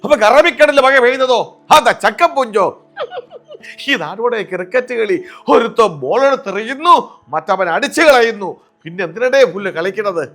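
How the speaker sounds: high, loud comic rant; mimicking the comedian's outraged rant; Jagadeesh impression. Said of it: "Shri Jagdish Sreekumar".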